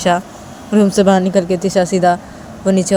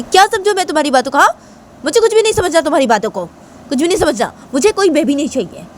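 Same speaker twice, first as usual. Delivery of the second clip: fast, high, acted outburst; heated rejection in a raised pitch; angry retort; 9 semitones higher than usual. A narrator's reading. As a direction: fire back without letting them argue. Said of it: "इतना गुस्सा मत करो ना".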